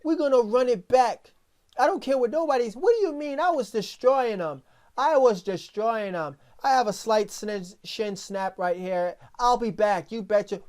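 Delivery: high, loud, cocky fighter impression; a swaggering fighter brushing off a snapped shin; McGregor trash talk. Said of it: "He said".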